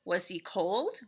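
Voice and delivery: usual speaking voice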